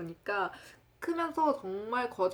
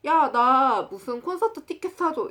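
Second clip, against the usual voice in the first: loud, higher-pitched pushy friend; 조르는 친구 흉내; 5 semitones higher, 8 dB louder than usual. Retold or imitated. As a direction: blurt out a big demand, imitating a friend. 친구가 무리하게 조르는 흉내. (친구가 크고 높게 조르듯)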